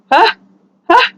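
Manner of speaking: normal talking voice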